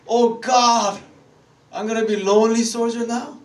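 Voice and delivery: high, loud cry to God; hammed-up wail of self-pity; mock crying lament. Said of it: "I was crying like".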